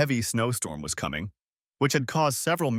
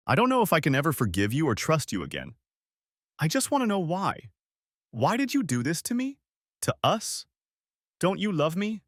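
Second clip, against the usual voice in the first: calm but sad; 6 semitones higher than usual. A narrator's reading. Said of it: "I spoke calmly, but there was sadness in my voice".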